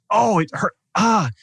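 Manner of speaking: high, mock pained "oh, it hurt, ah" as the knife twists. Client voiced feeling the twisted knife.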